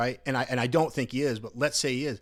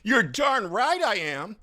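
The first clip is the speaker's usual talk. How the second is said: high, loud, slow mimicry; mock him as overwrought; mock emotional comedian; 9 semitones higher, 5 dB louder, slower than usual. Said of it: "He goes".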